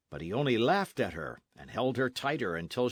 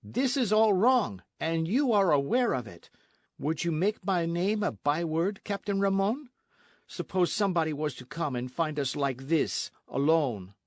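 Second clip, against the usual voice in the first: agitated, higher and emphatic; indignant rebuke; 5 semitones higher, 4 dB louder than usual. A narrator's reading. Señorita warns captain they may be found alone, indignant. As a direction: scold him with raised pitch.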